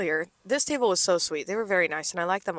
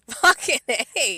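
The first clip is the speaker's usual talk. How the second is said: laughing; 5 semitones higher, 4 dB louder, much slower than usual. Real, not acted. Laughing at the retold comeback.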